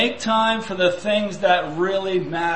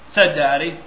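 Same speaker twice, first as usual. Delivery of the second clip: usual speaking voice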